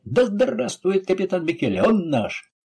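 high-pitched rousing cheer; crowd shouting. A narrator's reading. Mob hailing their captain, shouting in acclaim. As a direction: hail him like an excited crowd, narrator's restraint. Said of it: "закричали Лацарони".

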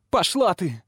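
high-pitched defiant cry; defiant outcry. A narrator's reading. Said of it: "выкрикнула в ответ девочка".

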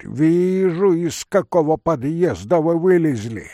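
high, loud rebuke; indignant retort. Acted. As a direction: snap back at the intruder. Старик гневно отвечает незваному гостю. (громкая гневная отповедь)

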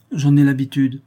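curt. A narrator's reading. Camille to Claire, brushing off concern, curt. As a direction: cut it off briefly. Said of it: "reprit-elle brièvement".

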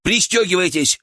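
narrated urgent command; loud command. A narrator's reading. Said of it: "громко приказал Илья".

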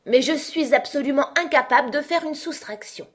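high-pitched, defensive queen; flustered queen. Acted.

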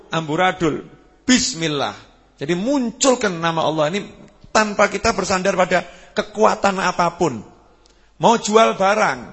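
rally the congregation to say Bismillah; stirring pulpit exhortation; preaching at full force. Acted. Rousing sermon climax.

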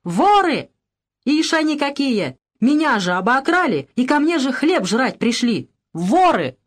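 high-pitched frantic accusations; frenzied shouting. A narrator's reading. Евдоким lunges at his guests, shouting in a frenzy. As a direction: cry out as if beside yourself. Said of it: "исступлённо кричал".

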